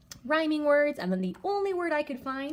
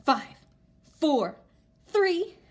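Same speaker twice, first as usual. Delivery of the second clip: rising higher and louder to a shouted blast; building countdown; 12 semitones higher, 7 dB louder, much slower than usual. Shown as a demonstration. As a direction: launch the rocket. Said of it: "Let's do our countdown".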